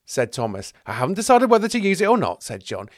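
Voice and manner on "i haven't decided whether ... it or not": high, loud, quick protest; raised, hurried self-justification; defensive retort. Acted. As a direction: rush out the defence. John defends himself.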